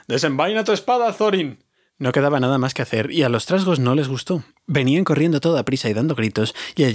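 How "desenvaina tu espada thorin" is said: much higher, pressing order; urgent command. A narrator's reading. Wizard urges Thorin to draw his sword, high urgent call. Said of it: "gritó el mago".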